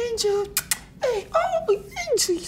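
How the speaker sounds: imitating her very high, sugary pet voice; tiny falsetto baby-talk; high pitch. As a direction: squeal it like cooing to a dog. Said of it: "she's speaking in this high pitch".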